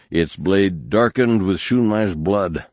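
ordinary delivery

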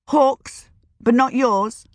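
mother challenging her son's refusal, sharp and high; demanding. A narrator's reading. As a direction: demand it back at him, stung. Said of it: "she demanded".

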